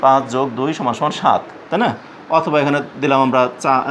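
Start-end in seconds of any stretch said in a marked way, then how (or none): none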